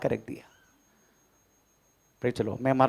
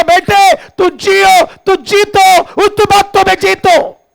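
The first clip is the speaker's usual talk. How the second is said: God's loving urging; 11 dB louder, slower than usual. Pretend. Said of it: "यही वो चाहता होगा".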